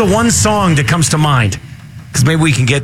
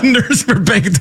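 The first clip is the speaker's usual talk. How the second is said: laughing through the words; giggling while repeating it; 3 semitones higher than usual. Real, not acted. Laughing at a funny bid.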